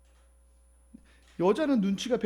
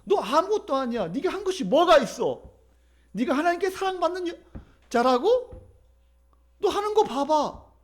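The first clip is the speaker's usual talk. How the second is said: higher-pitched scornful tempter; devil's belittling taunt; 8 semitones higher than usual. Acted. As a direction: belittle the listener with mocking questions. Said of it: "마귀는 우리에게 이렇게 속삭일 때가".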